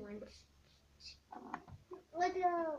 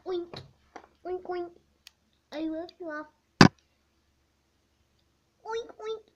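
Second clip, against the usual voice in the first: high oinking pig getting louder; pig oinks; 4 semitones higher, 15 dB louder than usual. Acted. Pig character oinks, growing louder. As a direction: oink like a pig, building to loud.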